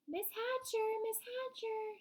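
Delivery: put-on high, eager girl's call. A narrator's reading. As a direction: call for her attention, pitched high. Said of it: "Sheila called".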